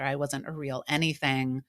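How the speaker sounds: ordinary delivery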